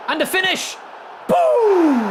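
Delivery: high, loud celebration; excited goal shout. Real, not acted. Streamer celebrating a goal, excited shout. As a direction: build to a booming whoop.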